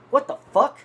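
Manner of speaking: high, loud mock disbelief; retold startled exclamation. Teller seeing what fell from the jacket, acting out his surprise. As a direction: burst out in retold shock.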